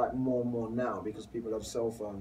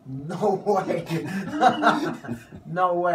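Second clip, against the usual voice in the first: laughing disbelief; 7 semitones higher, 10 dB louder, much slower than usual. Real, not acted.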